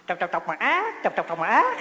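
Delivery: clucking words with a rising squawk at the end; quick repeated clucks, last word swooping up; cursing chicken. Retold or imitated. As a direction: imitate a hen scolding the monks. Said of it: "con gà mà nó chửi quý thầy á, biết nó chửi làm sao không?".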